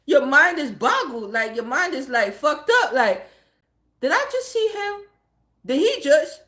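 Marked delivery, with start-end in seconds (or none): 4.0-6.4 high-pitched disbelieving question; retold incredulous shock. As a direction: imitate being stunned, trailing into a half question. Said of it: "like, shocked".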